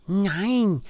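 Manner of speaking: high squeaky teddy voice; disguised voice. Acted. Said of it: "sagt Max mit verstellter Stimme". (verstellte Teddystimme)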